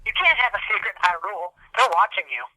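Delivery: normal talking voice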